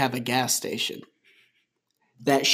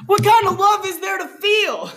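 much higher, laughing, incredulous; mock indignation; 4 dB louder than usual. Real, not acted. Mocking the characters for loving after five minutes, squealed in disbelief.

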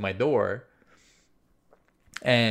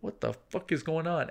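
soft, higher-pitched baffled question; spooked question to himself; hushed bewilderment; 5 semitones higher, 6 dB quieter than usual. Retold or imitated. Man finding no one at the door, hushed bewilderment. Watching neighbour through peephole.

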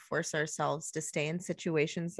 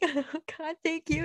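mock relief; 11 semitones higher than usual. Relieved reply to therapist.

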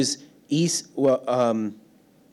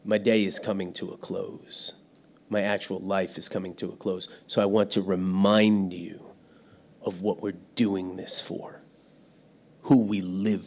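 Joshua reminding; 6 semitones lower, 6 dB quieter than usual. Retold or imitated. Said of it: "like Joshua was reminding them".

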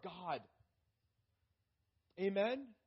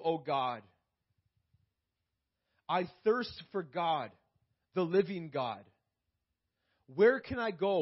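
solemn scripture reading; 3 semitones lower than usual. Acted. Preacher reads psalm aloud.

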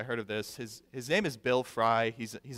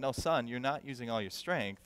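softer fatherly reassurance with a smile; gentle, smiling; 5 dB quieter than usual. Retold or imitated. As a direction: correct your son kindly and quietly. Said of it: "walk towards him and smiles and said".

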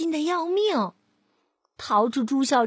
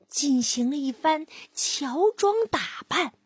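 ordinary delivery, nothing marked

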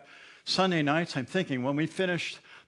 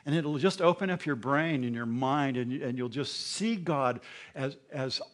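ordinary talk

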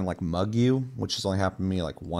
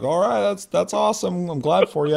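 higher-pitched imitated well-wishing; congratulating player; 10 semitones higher, 4 dB louder than usual. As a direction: call it after someone riding away, pitched up. Player calls after departing prospector.

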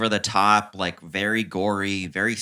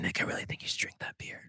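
conspiratorial whisper, quickly; very soft, voiceless nudge to a friend; hushed egging-on; 14 dB quieter, faster than usual. Retold or imitated. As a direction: whisper it like a bad influence.

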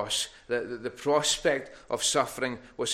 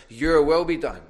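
the prayer flung out high and much louder; preacher's ringing quote at full volume; loud preached quote; 5 semitones higher, 12 dB louder than usual. Acted. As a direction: cry the words out to the congregation. Climax of preached question.

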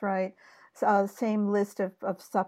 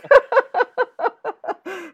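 high laugh; 13 semitones higher, 5 dB louder than usual. Real, not acted. Laughs during bit.

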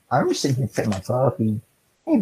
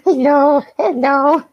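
high, louder laughing coo; 13 semitones higher, 4 dB louder, much slower than usual. Real, not acted. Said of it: "I'm not gonna do the baby voice".

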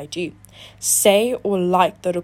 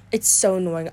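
normal talking voice

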